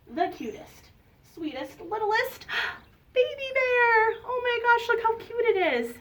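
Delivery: high, cooing gush with a gasp; squeaky-sweet adoring coo; gushing over cuteness. Acted. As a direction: melt over a tiny baby animal. Gushing over cute baby bear.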